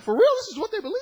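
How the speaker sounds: high, loud shocked question; preacher reacting to the beliefs; disbelief. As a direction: sound stunned by what you read. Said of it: "I'm like".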